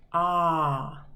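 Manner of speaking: the middle vowel ah alone; just the ah sound; isolated vowel ah. Shown as a demonstration. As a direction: open ah held briefly. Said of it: "what the middle sound is".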